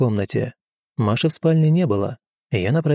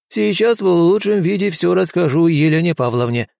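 put-on very old man; 5 semitones higher than usual. A narrator's reading.

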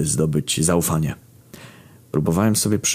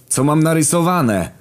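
exasperated demand; 11 semitones higher than usual. Retold or imitated. Demanding answer from Kamil.

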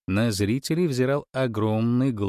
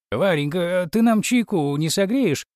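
hasty cheerful diversion; 8 semitones higher, 4 dB louder than usual. A narrator's reading. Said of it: "ринулся на подмогу".

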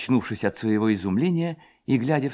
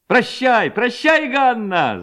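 high, raised repeated farewell; several lads shouting; 13 semitones higher, 4 dB louder, much slower than usual. A narrator's reading. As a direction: call out high, one cry after another. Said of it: "закричало несколько парубков". (высокие выкрики парней)

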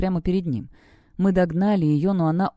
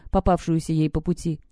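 ordinary delivery

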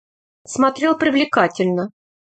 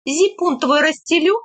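higher-pitched practical question; businesslike question; 6 semitones higher than usual. A narrator's reading. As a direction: ask it matter-of-factly, pitch up. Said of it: "тем же тоном спросила".

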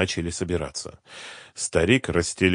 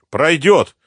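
high, loud, confident one-word reply; with conviction; 10 semitones higher, 9 dB louder than usual. A narrator's reading. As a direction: assert it firmly and loudly. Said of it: "убеждённо сказал его собеседник". (убеждённо, громко)

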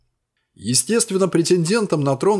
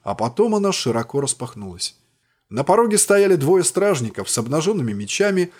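plain reading voice

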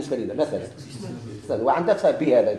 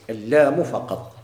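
اللام lengthened and leaned on, then فقط slowly; slow, emphatic اللام فقط; stress on اللام; 3 semitones lower, slower than usual. Shown as a demonstration. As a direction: draw out اللام فقط to drive the point home. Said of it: "شوف".

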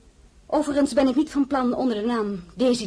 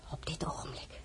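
zacht beslist; 6 semitones lower, 16 dB quieter than usual. Acted. Vastberaden antwoord aan de koningin.

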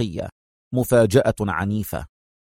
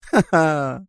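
high, taunting villainess laugh; playful laugh; 4 semitones higher than usual. A narrator's reading. Sonia laughs off Adham's threat, playful laugh. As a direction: burst into a short mocking laugh. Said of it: "ضحكة عابثة قوية".

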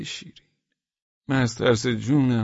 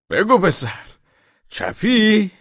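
higher, louder demand; interrogating shout; 9 semitones higher, 6 dB louder, much slower than usual. Acted. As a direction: bark the question with each blow. Policeman repeating the question. (بازجویی با فریاد بلند پلیس)

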